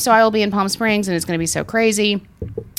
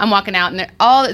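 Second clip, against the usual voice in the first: usual speaking voice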